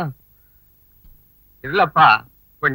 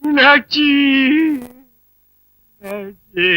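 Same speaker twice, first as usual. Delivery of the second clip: mournful calling; 9 semitones higher, slower than usual. Acted. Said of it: "ஏன்னே அழுகுறீங்க?".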